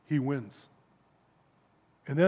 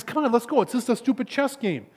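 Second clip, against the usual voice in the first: higher-pitched, lightly acted impatience; impatient friend; 6 semitones higher than usual. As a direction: urge a friend to move along, mildly fed up.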